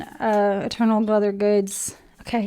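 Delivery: ordinary talk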